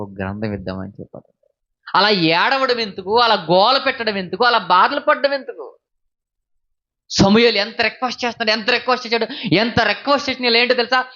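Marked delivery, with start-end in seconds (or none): 1.9-11.0 high, loud preaching with rising force; fiery preaching. Acted. Preacher pressing the congregation about their demand for a king, high and loud. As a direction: preach it out hard to the crowd.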